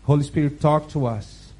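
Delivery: ordinary talk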